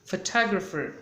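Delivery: Photographer in an American accent, fə-TA-grafər with a rolled r at the end. Shown as a demonstration. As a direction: stress Photographer on TA, American style. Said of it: "pronounced like that in American accent".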